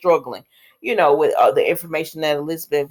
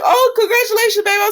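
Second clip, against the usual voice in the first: gushing congratulations; 3 dB louder than usual. Retold or imitated. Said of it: "She's like".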